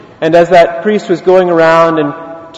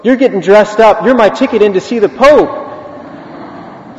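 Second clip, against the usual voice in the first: higher, comically urgent order; eager insistence; 5 semitones higher than usual. Retold or imitated. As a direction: talk a reluctant friend into it. Priest insists beggar comes along.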